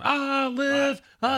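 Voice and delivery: high, drawn-out crowd holler; imitated yell. Little groups spotting their graduate, imitated yell. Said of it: "little groups of people yelling".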